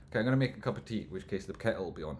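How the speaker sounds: normal talking voice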